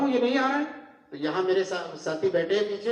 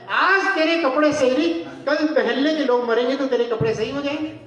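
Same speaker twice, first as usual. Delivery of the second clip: scornful rebuke; 6 semitones higher than usual. Acted. Preacher scolding the son at the funeral, scornful. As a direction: throw the excuse back in his face.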